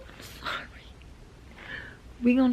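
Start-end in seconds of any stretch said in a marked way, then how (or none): 0.1-0.7 whispered sorry. Real, not acted. Entschuldigung an die Zuschauer.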